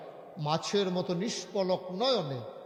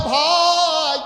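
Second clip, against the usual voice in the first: loud, high, long-held call; crying out; 10 semitones higher, 14 dB louder, much slower than usual. Retold or imitated. Tearful companion calling to his brother, loud drawn-out retold cry. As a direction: hurl out an impassioned chanted cry. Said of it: "ডাক দেয়া কয়".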